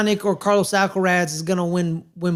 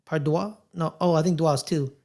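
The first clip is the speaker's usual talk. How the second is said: ordinary talk, nothing marked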